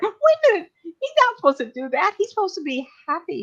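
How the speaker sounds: object, amazed at the story; shocked protest. Real, not acted. Speaker reacts to the fall.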